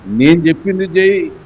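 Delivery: mother insisting her son obey; firm command. Retold or imitated. Mother ordering her son, firm loud command. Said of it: "అంటారు".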